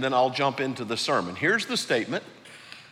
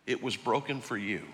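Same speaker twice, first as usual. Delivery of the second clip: quoting Jesus softly; 6 dB quieter than usual. Retold or imitated. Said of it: "He said".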